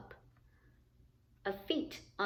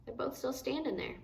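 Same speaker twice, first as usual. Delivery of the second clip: much softer, quicker aside; hushed aside; 11 dB quieter, faster than usual. Real, not acted. Reader to child, a much softer quick aside. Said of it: "hushed aside to listener".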